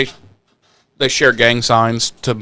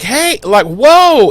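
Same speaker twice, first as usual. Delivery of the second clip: high surprised calls; startled gang; 10 semitones higher, 5 dB louder, much slower than usual. Retold or imitated. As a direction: react as if startled. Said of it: "they're like".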